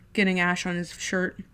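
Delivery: ordinary delivery, nothing marked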